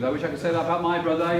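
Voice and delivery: ordinary delivery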